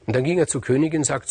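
ordinary delivery

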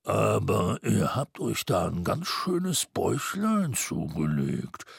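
Mister Xmas mocking Scrooge's belly, low and spiteful. A narrator's reading. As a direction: slip in the dig with malice. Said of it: "nicht ohne gehässigen Unterton".

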